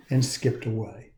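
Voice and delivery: ordinary delivery, nothing marked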